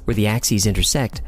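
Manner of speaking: usual speaking voice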